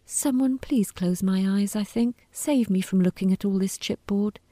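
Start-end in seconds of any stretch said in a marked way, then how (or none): none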